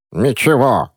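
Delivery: indignant. A narrator's reading. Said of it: "возмущённо заявила София".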